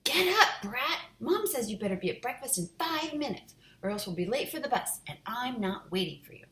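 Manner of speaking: high, loud bratty sister; bossy yell. Acted. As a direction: shout him out of bed. Sister yelling to wake him.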